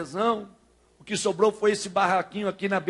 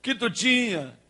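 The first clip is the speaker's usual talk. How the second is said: higher, louder mock-angry demand; retold angry outburst; 5 semitones higher, 5 dB louder than usual. Father confronting his son over the hidden number, retold angry outburst. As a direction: act out a cross father's demand. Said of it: "E ele ficou bravo".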